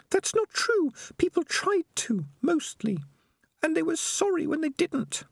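put-on high, earnest young rat girl; Peaches, pleading and firm; young female rat voice. A narrator's reading.

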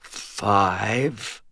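soft, effortful mutter. A narrator's reading. Struggling knight tests his twitching fingers, muttering. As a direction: mutter it faintly. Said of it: "I muttered".

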